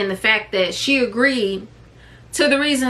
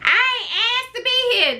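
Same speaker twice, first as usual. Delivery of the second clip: mocking kids; 13 semitones higher than usual. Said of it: "for those of you out there saying".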